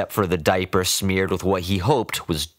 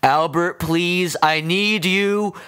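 shouting; 11 semitones higher, slower than usual. A narrator's reading. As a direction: shout for help, pleading. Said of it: "Stavin shouted".

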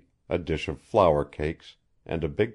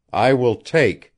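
louder, cutting in firmly; decisive; 7 semitones higher, 9 dB louder than usual. A narrator's reading. Howland cuts off Jean's warning, loud and decisive. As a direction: settle it, no more argument. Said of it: "interrupted Howland decisively".

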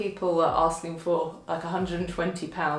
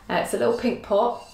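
normal talking voice

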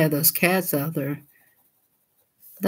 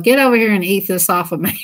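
laughing shout; faster than usual. Retold or imitated. Yelling at cat, laughing.

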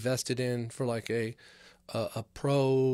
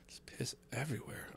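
hushed, no voice at all; whisper; 12 dB quieter than usual. Real, not acted. Whispering to podcast fans about the streets of Vegas. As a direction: whisper it like a gross secret.

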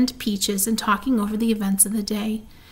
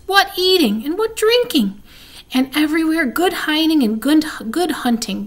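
higher, exclaiming with relish; revelling rat; 5 semitones higher, 3 dB louder than usual. A narrator's reading. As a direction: relish each delight of the fair. Rat revels in fair's delights.

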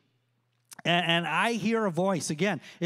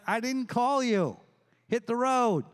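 higher-pitched mild exasperation; Eli, exasperated; 5 semitones higher than usual. Acted. Eli to Samuel waking him a second time, exasperated. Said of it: "He's like".

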